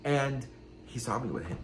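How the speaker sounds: usual speaking voice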